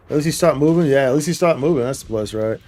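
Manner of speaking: ordinary delivery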